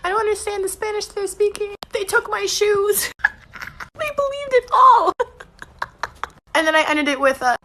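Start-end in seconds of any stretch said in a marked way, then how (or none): 0.0-3.1 higher, lost and pleading, slowing at the end; mock helpless prisoner. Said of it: "I just said". 4.0-5.2 high, slow giggly glee; gleeful laughing. Real, not acted. Gleeful at her prank.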